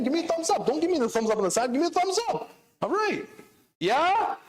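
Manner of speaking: high, teasing demand for thumbs up; high playful coaxing; playful urging. Real, not acted. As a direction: egg the crowd on.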